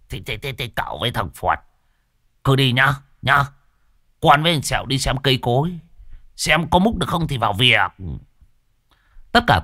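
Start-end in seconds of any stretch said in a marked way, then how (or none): 0.1-7.9 lightly voiced old man, a bit higher, stuttering at first; a hint of a flustered old Đồng; nervous old villager, mildly voiced; stammering old man. Old man answers the master.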